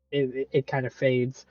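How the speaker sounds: ordinary talk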